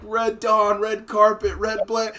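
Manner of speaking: high, loud calling out; mock alarm calls. Everyone forgets the phrase, loud mock alarm. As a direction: call out garbled code phrases in mock alarm. Panicked bystanders fumbling the emergency phrase.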